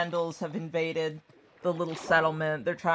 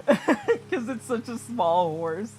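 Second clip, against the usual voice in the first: laughing; 5 semitones higher, 4 dB louder, slower than usual. Real, not acted. Laughing through the punchline.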